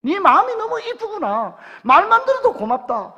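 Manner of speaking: God warmly answering David in Gyeongsang dialect; tender voice of God. Acted. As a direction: voice God gently telling David he is dear. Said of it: "우리 경상도 말로 하면 뭡니까?".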